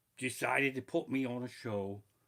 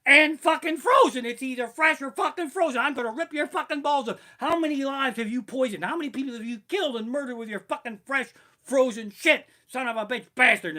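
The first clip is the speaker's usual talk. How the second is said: screaming furious chef, much higher and louder; full-throated kitchen tirade at a cook; Ramsay rant; 10 semitones higher, 11 dB louder than usual. Retold or imitated. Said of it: "Chef Ramsay goes".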